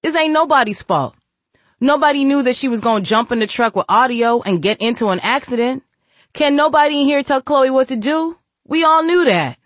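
high, loud, fast insistence; shouting. Acted. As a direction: shout it down, nobody's fault. Shadow shouts denial of blame.

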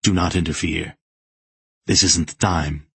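mildly sharp command; a stern, restrained warning; sharp inner voice. A narrator's reading. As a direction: forbid it with a touch of sharpness. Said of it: "the voice within his mind said sharply".

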